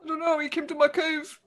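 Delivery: high-pitched frightened ogre voice; timid ogre. Acted. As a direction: answer like a scared, simple ogre child. Ogre answers under questioning.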